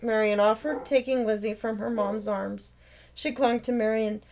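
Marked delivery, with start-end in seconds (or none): none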